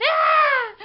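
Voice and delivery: shrill, loud scream of fright; terrified scream. Acted. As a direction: scream it out, wide open with fear. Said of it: "I screamed".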